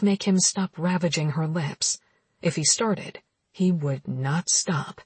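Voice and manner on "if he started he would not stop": ordinary delivery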